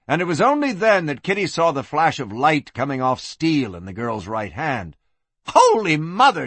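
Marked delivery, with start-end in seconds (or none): 5.5-6.5 high startled exclamation; alarmed cry. Acted. Madam spots a knife, alarmed cry. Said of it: "she cried".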